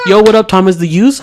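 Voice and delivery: loud high cool-guy hello; barista slang greeting. Retold or imitated. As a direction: chirp it like a hip barista. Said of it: "they're like".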